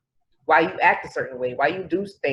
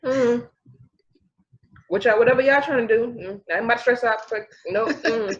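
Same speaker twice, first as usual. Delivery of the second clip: higher, breezy don't-care voice; mock carefree shrug; 7 semitones higher than usual. Imitating easygoing friends, a breezy high shrug. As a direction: shrug it all off lazily. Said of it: "They'd be like".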